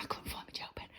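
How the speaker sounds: sudden soft whisper, no voice. Real, not acted. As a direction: whisper it like a guilty aside. Confessing lost pen aside.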